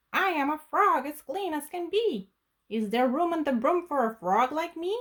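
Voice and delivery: mild croaky frog voice. Acted. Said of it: "then said with a croak".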